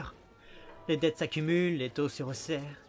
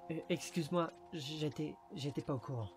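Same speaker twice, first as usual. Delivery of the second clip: very soft, hesitant, halting; subdued apology; 11 dB quieter than usual. Acted. Detective learns of the mother's death, apologising softly and haltingly. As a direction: drop the voice and apologise awkwardly.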